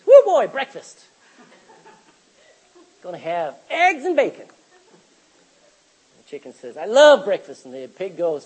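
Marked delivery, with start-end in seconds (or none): 0.1-4.5 loud gleeful whoop, voice jumping up; excited chicken. Acted. Preacher's joke, the chicken gloating to the pig, loud and gleeful. 6.8-7.7 loud delighted cry, love punched; gleeful chicken. Acted. Chicken cheering over breakfast in the preacher's joke, loud delight.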